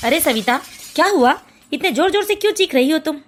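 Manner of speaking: a husband's low voice, puzzled by the shouting; father's voice. Acted.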